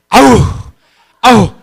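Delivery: loud, high exasperated groan; frustrated groan. Retold or imitated. Pastor mimicking the frustrated sixth grader, loud groans. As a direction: groan through held-back anger.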